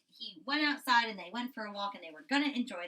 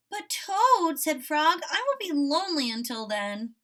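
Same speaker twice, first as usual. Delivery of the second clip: high and louder, begging; pleading Frog; 6 semitones higher, 7 dB louder than usual. A narrator's reading. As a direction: beg him not to sleep.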